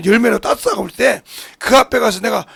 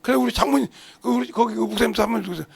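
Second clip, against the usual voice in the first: ordinary talk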